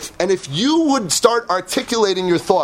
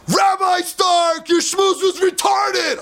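mock shout; 8 semitones higher, much slower than usual. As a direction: bellow it like a brash fan. Visitor yells at the rabbi.

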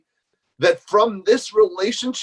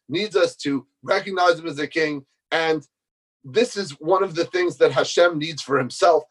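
usual speaking voice

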